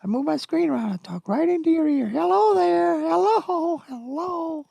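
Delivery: very high squeaky cartoon mouse; Mickey Mouse voice. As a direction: talk right into their ear like Mickey. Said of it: "I think Mickey has taken over my voice".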